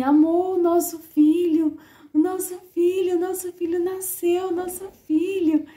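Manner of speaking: high, loud re-enactment of an overjoyed cry; tearful joy, re-enacted. New mother telling her partner their son is born, re-enacted tearful joy. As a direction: raise the voice high, acting out overwhelmed new-parent joy. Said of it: "eu comecei a chorar".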